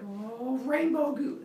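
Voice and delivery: high, dopey amazement; voz alta y maravillada de humano simple; awed delight. Acted. Dopey humans marvel at goo.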